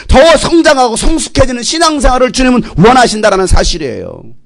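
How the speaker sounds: rousing sermon delivery; full-force preaching. Acted. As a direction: exhort the congregation with fervour.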